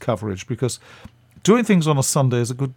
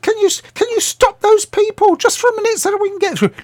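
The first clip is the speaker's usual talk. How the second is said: much higher, louder put-on voice of an elderly actress, pleading sweetly; Patricia Hayes; 5 dB louder than usual. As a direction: pitch it way up and ask a favour. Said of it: "she said".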